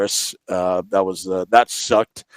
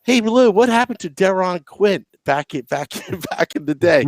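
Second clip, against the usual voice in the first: higher-pitched mock reporter calling out; press conference reporter; 6 semitones higher than usual. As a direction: pipe up with a question for the coach. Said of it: "a press conference and be like".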